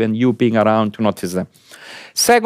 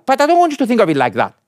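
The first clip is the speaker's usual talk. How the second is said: ordinary delivery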